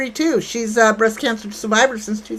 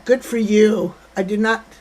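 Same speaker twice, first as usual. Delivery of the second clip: usual speaking voice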